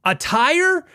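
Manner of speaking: high, loud disbelieving "A tire?"; shocked. Retold or imitated. Customer shocked at tire price, high and loud. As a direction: gasp out the question in disbelief.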